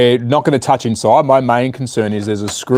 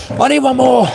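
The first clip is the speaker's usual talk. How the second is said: "I need one more" lifted high and sung; high sing-song; 13 semitones higher than usual. Acted. Repeats phrase sing-song.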